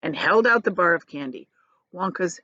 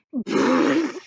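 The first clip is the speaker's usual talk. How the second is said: higher hearty laughing burst; laughter burst; 7 semitones higher than usual. Acted. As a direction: laugh at the joke. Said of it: "burst into laughter".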